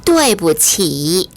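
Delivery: 对不起 slow and clearly separated; repeat-after-me model. Shown as a demonstration. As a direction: model 对不起 syllable by syllable for learners, drawn out. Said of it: "원어민의 발음을 잘 듣고 따라해 볼까요".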